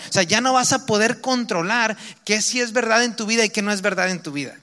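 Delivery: normal talking voice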